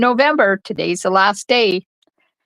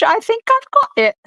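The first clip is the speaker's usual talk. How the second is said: high, posh-style British My Fair Lady exclamation; British accent, triumphant. Pretend. Crafter cheering her card in a British accent. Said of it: "she says with a British accent".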